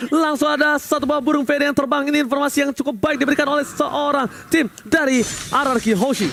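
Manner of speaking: high, excited play-by-play call; fighting game announcer. Real, not acted. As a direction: hype the play like a live caster. Hyped caster call of a play.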